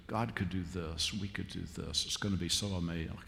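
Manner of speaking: soft, low voice of dawning possibility; low and hushed, awestruck; hushed wonder. Acted. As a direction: imitate visitors quietly dreaming aloud. Visitors dreaming about the possibilities.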